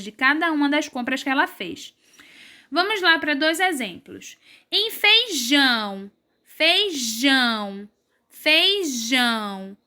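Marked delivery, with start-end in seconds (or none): 4.9-9.8 "feijão" slowly drawn out, weight on final JÃO; stress on JÃO. Shown as a demonstration. Teacher giving pupils an example, slow "feijão" with strong JÃO. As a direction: stretch JÃO in each "feijão". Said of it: "A sílaba mais forte é o jão".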